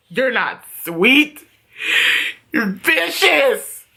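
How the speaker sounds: slow, halting acted comeback; labored, slow imitation of a beaten man; beaten man's retort. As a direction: drag out each word with dramatic pauses.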